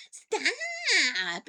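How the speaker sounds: loud, high, drawn-out wail of protest; whiny, stretched-out yelp at a pest; exasperated stop. Retold or imitated. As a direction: wail it out in mock annoyance. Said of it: "poke me in the arm and I'd go".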